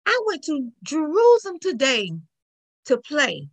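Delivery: put-on high-pitched child telling news; little girl's voice. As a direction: tell it like a small child. Young daughter telling her news.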